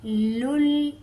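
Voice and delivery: लुल with a firm doubled lam; tajweed लुल; triple lam. Shown as a demonstration. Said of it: "हम इसे कैसे पढ़ेंगे?".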